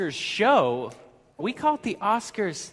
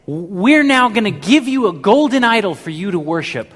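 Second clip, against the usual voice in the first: retelling a quote loudly; 3 semitones higher, 10 dB louder than usual. Retold or imitated. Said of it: "And he says".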